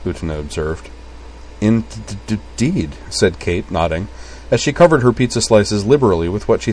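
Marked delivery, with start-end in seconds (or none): 1.6-2.9 halting, stuttered agreement; stammering. A narrator's reading. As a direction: stutter on the D of "Indeed". Kate stammers in story.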